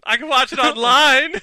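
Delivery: high, loud gloating through laughter; laughing tease. Real, not acted. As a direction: crow it while cracking up. Teasing friend about Breaking Bad.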